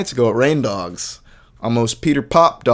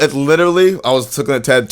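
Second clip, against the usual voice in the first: usual speaking voice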